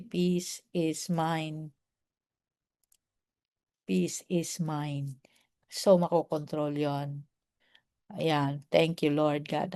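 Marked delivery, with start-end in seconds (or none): 0.0-5.2 much softer, very slow "Peace is mine"; soft slow mantra. Shown as a demonstration. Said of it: "You need to say". (mahina at mabagal na "Peace is mine")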